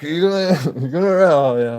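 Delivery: can't believe it, laughing; laughing disbelief. Retold or imitated. Asking the officer about arrest, loud laughing disbelief.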